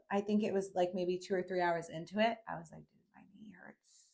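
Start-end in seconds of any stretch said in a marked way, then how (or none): 2.8-4.1 whispered complaint of pain. Retold or imitated. Mid-hike to herself, whispered pained complaint.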